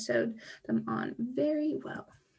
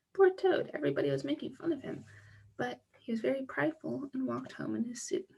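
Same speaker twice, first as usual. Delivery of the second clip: ordinary delivery, nothing marked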